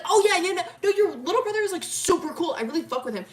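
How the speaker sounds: loud, high gushing; excited rambling. Retold or imitated. Milo gushing about Ellie's little brother, excited rambling. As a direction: start high and loud, easing at the end. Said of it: "he starts talking, like, really fast".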